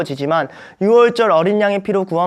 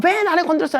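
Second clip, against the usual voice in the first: much higher, petulant "왜" whine; mock whining complaint; 12 semitones higher than usual. Grumbling people complaining to Moses, mock whine. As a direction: squeak it like a sulky child. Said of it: "백성들이 막 원망하고".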